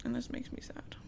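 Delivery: soft unvoiced confiding aside; whispered aside. Real, not acted. Podcaster confiding to her cohost, whispered. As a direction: whisper it like a secret confession. Aside while recapping a sad scene.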